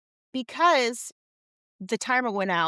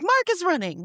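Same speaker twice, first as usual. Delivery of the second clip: high-pitched, delighted fan cry; excited cheering; 7 semitones higher, 3 dB louder than usual. Retold or imitated. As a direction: mimic excited fans spotting their favorite player. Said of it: "we get excited to see Mark running".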